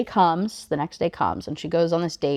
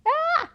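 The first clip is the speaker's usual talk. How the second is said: short very high-pitched squeal. Real, not acted. Reader reacting as the date begins, high squeal. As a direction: let out a tiny high yelp.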